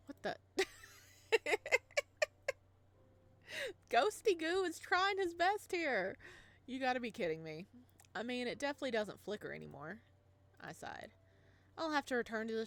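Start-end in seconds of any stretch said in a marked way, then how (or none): none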